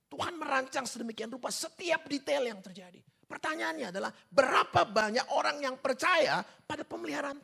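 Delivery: high-pitched, insistent sermon delivery; impassioned preaching. Real, not acted. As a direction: press the question on the congregation, voice lifted. Preaches at full force.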